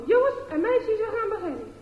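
teacher calling the pupils to start. Acted. Teacher calls the class.

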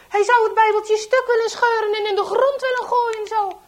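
high, loud, driven outburst; furious narration. A narrator's reading. Telling how traitor would tear the Bible, furious.

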